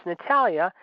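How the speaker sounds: usual speaking voice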